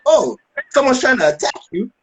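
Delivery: high-pitched imitation of alarm; mock outraged realization, voice way up; alarmed wife.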